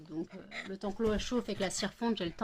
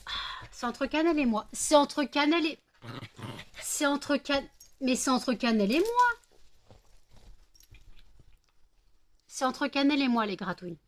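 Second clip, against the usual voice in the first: telling off the dog; 6 semitones higher than usual. Real, not acted. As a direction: keep the other dog away firmly. Repousse Melba, parle fort. (voix haute et insistante envers la chienne)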